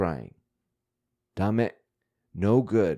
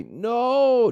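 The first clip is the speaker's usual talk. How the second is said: long, much higher, louder hammed-up "No"; dramatic mock wail; 14 semitones higher, 6 dB louder than usual. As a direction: wail it like a sulking cartoon kid. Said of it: "dramatic crying sign".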